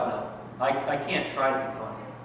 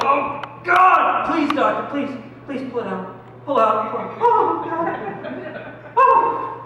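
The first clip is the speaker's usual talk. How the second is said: much higher, louder frantic begging; desperate pleading; 9 semitones higher, 6 dB louder, much slower than usual. Retold or imitated. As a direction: beg the doctor in panic, voice shooting up. Begging doctor during prostate exam.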